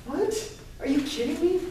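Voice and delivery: put-on high, shocked child's cry of disbelief; squeaky outraged what. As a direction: react like a stunned third grader. Child reacts to friend's dismissal.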